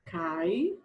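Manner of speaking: caixa split slowly into its syllables, cai-xa; separating caixa into syllables for the class. Shown as a demonstration. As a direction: say caixa syllable by syllable, rising at the end. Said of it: "Ó".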